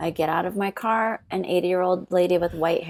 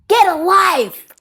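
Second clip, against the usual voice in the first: louder, higher snap; angry retort; 10 semitones higher, 6 dB louder, slower than usual. Retold or imitated. Retelling telling off the old lady in the parking lot, loud angry retort. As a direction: throw it in her face.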